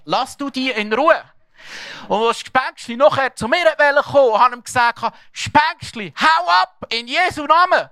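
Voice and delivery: the father tells the congregation his son's story, preaching full force. Acted. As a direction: build the story to a triumphant shout. Retells son's victory at full force.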